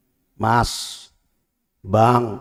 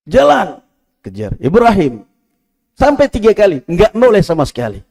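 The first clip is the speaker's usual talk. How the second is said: high, loud emphatic preaching; fiery preaching; 9 semitones higher, 7 dB louder than usual. Acted. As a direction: hammer the story's climax. Emphatic sermon climax.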